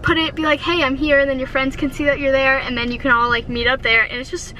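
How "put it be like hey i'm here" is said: normal talking voice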